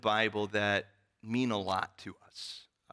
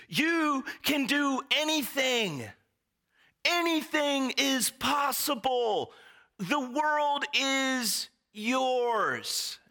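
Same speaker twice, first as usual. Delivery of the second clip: much higher and slow, a grand booming proclamation; hyped-up athlete; 3 dB louder, slower than usual. Retold or imitated. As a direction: preach it like a pumped-up star who just won the game. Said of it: "Charlie Sanders' main message in the sketch is".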